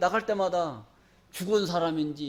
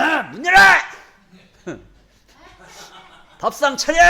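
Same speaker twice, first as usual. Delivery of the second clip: laughing mock bellow of a cocky husband; husband bossing loudly; 6 semitones higher, 9 dB louder than usual. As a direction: boom out orders like a man who just got rich. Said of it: "큰 소리 치는 거야". (돈 번 남편이 아내에게 큰소리치며, 웃음 섞인 호통)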